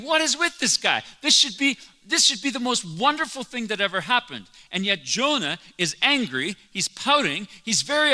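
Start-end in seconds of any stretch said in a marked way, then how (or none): 0.0-4.5 high, incredulous voice of a puzzled listener; baffled onlooker. Retold or imitated. Listener puzzled by sulking Jonah, high incredulous voice. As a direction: squeak out the disbelief, much higher. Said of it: "You're thinking".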